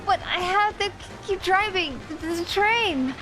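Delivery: high, stammering driver voice; nervous train driver. Acted. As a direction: play a flustered driver tripping over words.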